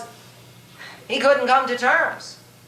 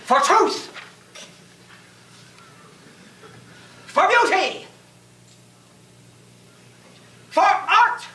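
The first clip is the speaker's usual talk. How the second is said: high, ringing rallying cry; battle cry; 7 semitones higher than usual. Acted. Knight declaring what he fights for, rallying battle cry. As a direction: cry each cause out, higher each time. Battle cries before the charge.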